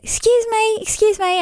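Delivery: very high, bright calling excuse me. Retold or imitated. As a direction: call out high to get his attention. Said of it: "And I said".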